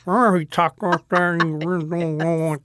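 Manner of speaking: very slow, drawn-out Southern mumble; comic slurred Southern drawl; Heath Ledger impression. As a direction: mumble it through as a slow cowboy. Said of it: "Heath Ledger doing Brokeback Mountain".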